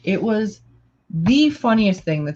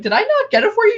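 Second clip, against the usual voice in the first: very high-pitched mock disbelief; retold incredulous question; 4 dB louder, much faster than usual. Friend recalls asking about fetching it, high incredulous question. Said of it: "And I'm like".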